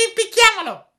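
shrieking, very high villain voice; second assassin's echo. A narrator's reading. Accomplice repeats the hanging order, high loud squawk. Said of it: "ripeté l'altro".

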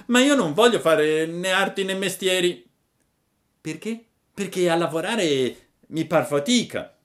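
put-on high, loud puppet boy refusing; sulky Pinocchio. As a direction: whine your refusal. (Pinocchio capriccioso, voce alta)

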